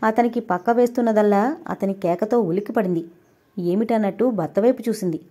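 plain reading voice

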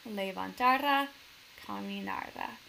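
Levantará and caminará as le-van-TA-ra, ca-mi-NA-ra; énfasis en la penúltima. Shown as a demonstration. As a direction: stress the next-to-last syllable in Levantará and caminará. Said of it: "con mi voz en la penúltima". (Levantará, caminará con acento en TA y NA)

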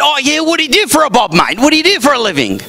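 high, quick-fire mock Aussie; broad Australian accent. Aussie asking newcomer about his job, quick mock Australian accent. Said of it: "compared to their accent".

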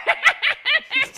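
loud, high-pitched laugh; a sudden shrieking laugh; high burst of laughter. Real, not acted.

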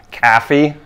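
"caffey" with a flat open a, from the back of the throat; northern Wisconsin caffey. Shown as a demonstration. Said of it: "you say it with, like, the back of your throat almost".

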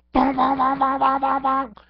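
high, loud cartoon bong noises; bonking sound effect. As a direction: make the toy's hitting sounds. Imitating the toy hitting.

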